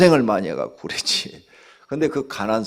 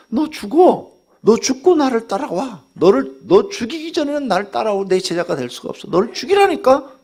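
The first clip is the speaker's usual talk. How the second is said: loud, high commanding call; Jesus's command, shouted; 10 semitones higher, 5 dB louder than usual. Retold or imitated. Preacher voicing Jesus demanding the disciple die to self, shouting. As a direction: thunder it as the Lord's demand. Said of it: "예수님 말씀".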